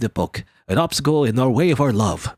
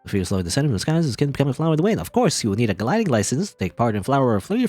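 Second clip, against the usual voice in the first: rushed announcer read; reading game text aloud in one breath. Shown as a demonstration. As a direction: race through it in one breath. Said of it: "I tried to read that all in one breath".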